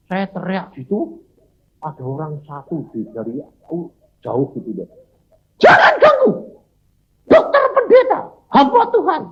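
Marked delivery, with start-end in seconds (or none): 5.6-9.2 very high, loud call across a distance; man far away yelling at the rebels to leave him; calling out from afar. Retold or imitated. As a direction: shout to stop them from far off.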